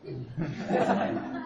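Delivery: low breathy chuckle; short laugh. Real, not acted. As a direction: chuckle to yourself. Teacher laughs after student answer.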